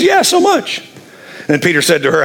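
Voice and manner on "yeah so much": high breezy lie. Acted. Wife lying to Peter.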